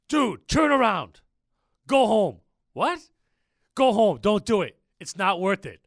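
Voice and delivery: loud, slow, urgent warning shouted down the phone. Retold or imitated. Buddy on the phone warns him off the vasectomy, shouting urgently. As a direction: yell it like you're stopping a friend in time.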